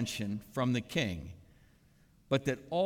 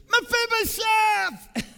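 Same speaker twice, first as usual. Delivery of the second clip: very high, loud, drawn-out delighted call; joyful shout; 9 dB louder, slower than usual. Acted. King David overjoyed greeting the crippled son, shouting. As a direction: cry out his name slowly with joy. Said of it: "See the exclamation point after Mephibosheth?".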